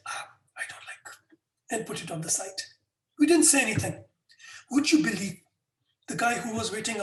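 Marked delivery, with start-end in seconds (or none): 0.1-1.1 soft whispered distaste; a mild whispered grimace; whispered dislike. Retold or imitated. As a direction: breathe it out, put off by the taste. Friend rejecting bitter drink.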